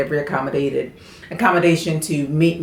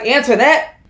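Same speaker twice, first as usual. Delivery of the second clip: a hint of Southern American, pitched higher; Southern accent; 6 semitones higher than usual. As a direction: imitate a Southerner calling out a name. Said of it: "American Southern, Southernist accent".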